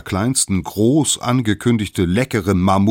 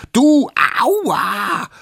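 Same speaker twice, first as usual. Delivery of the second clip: high, loud acted "ow" shout; squealing outcry in a character voice; pained yell; 8 dB louder than usual. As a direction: yelp as if just bitten. Bitten character yells in pain.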